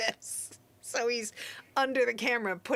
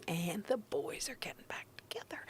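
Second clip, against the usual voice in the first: whispering; 11 dB quieter than usual. Real, not acted. Gossiping aside.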